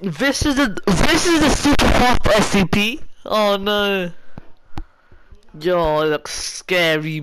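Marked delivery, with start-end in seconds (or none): 0.0-4.1 higher, louder excited rush. Real, not acted. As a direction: gush about the monster. Excited at the SCP.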